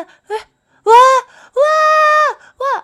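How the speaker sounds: loud, high startled wailing "waah"; panicked scream. Acted. Fleeing player screams at seeing a ghost, panicked. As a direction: scream out in fright, stretched long.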